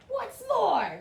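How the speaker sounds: high, loud snapping retort; sharp snap. A narrator's reading. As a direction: bite the words off angrily. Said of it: "snapped the Lorax".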